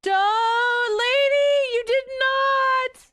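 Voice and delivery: high, loud, drawn-out squeal of disbelief; mock outrage. Streamer squealing back at a chat joke in mock outrage. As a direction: stretch it out in a shrill-free high mock protest.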